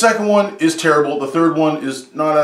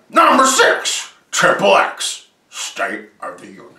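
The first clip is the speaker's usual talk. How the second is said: countdown announcer; 2 semitones higher, slower than usual. Acted. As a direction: announce the entry like a game show host. Countdown title card.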